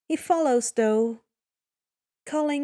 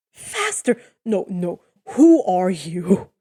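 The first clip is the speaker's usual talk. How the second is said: alarmed disbelief; 4 semitones lower, 6 dB louder, slower than usual. Acted. Odysseus awed by Percy's power, loud and slow. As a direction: recoil in awe and demand answers.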